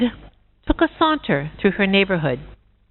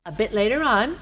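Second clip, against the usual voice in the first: plain reading voice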